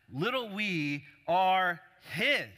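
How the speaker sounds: slow and high, "his" punched loud; stress on HIS. Shown as a demonstration. Preacher showing the congregation the sentence's emphasis, big stress on "his". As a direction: stretch each word out, then hit "his" hard and let it fall. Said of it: "That's the emphasis in the sentence".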